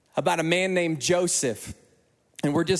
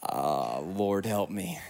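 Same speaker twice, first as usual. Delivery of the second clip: low, hushed plea to heaven; a half-joking whispered prayer of doom; young man dreading the manager's summons, hushed and low; mock dread prayer; 12 semitones lower, 5 dB quieter, much slower than usual. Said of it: "And I'm like".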